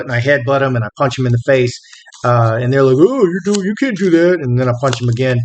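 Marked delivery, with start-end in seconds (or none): 3.0-4.4 lightly put-on higher voice of startled attackers; mock protest. Men who tried to take his phone, in mock protest. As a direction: imitate their objection, pitched up.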